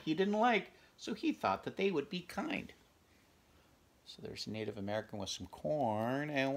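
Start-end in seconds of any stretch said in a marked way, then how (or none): none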